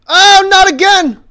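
high, slow anguished yell; loud outcry. Real, not acted. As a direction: wail it out in despair. Game death outcry.

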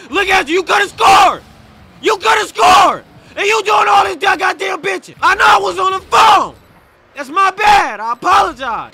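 high, loud shouting at a teammate; furious rant. Real, not acted. Gamer yelling at teammate who complains about him. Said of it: "you done got me out my body".

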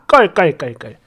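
낮게 읊는 가짜 웃음소리; 웃는 소리를 글자 그대로 흉내 내기. Acted. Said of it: "두 사람이 저를 쳐다보고 웃는데". (낮은 목소리로 깔깔 웃는 시늉)

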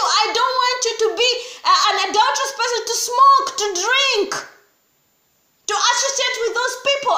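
loud, high voice of Jesus pleading; voicing Jesus. Preacher voicing Jesus inviting the listener to talk, loud and pleading. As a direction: speak as Jesus begging them to talk. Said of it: "And he says to you".

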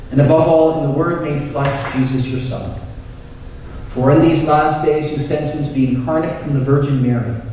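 usual speaking voice

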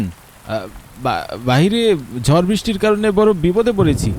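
ordinary delivery, nothing marked

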